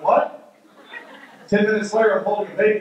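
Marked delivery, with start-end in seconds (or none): 0.0-0.5 higher-pitched mock-startled "what"; startled reaction. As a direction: act out a moment of disbelief. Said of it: "And I'm like".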